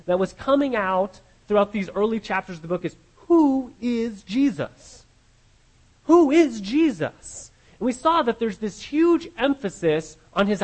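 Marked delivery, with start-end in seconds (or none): 3.3-7.5 slow, drawn-out, rising questioning; dramatic slow question. Acted. Preacher posing the question to the congregation, slow and emphatic. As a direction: let the question hang, slow and higher.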